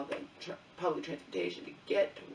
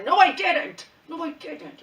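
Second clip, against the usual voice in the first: much higher and louder, near-shouted denial; furious denial; 10 semitones higher, 14 dB louder, slower than usual. Retold or imitated. Daughter retelling denying to the phone rep, shouted outrage. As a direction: yell it back, outraged. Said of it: "She's arguing with me".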